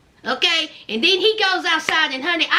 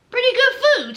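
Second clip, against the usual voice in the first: higher emphatic praise; 6 semitones higher than usual. Acted. Vlogger praising the restaurant food, higher and stronger. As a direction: lift it and lean in on food. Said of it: "repeated food praise".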